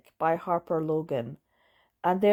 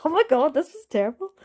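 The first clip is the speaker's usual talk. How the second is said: high, fast oh my god; laughing exasperation; exasperated outburst; 8 semitones higher, 3 dB louder, faster than usual. Real, not acted. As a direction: blurt it out in disbelief. Laughing disbelief at a bad book.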